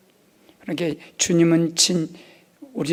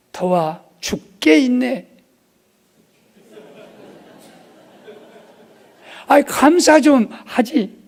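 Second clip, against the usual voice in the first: mock scolding; 6 semitones higher, 5 dB louder, slower than usual. Husband rebuking complaining wife.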